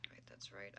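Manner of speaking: whispered aside to himself; realizing mistake, whispering to himself; whisper to self. Real, not acted. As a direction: breathe it under your breath, realizing. Streamer muttering to self during setup.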